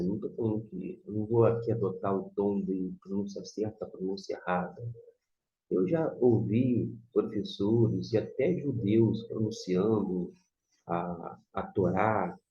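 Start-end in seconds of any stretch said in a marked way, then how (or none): none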